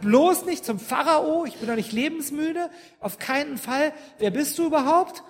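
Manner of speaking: hammed-up frightened protest; panicked Moses. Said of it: "Mose sagt". (aufgeregte, panische Abwehr, höher und lauter)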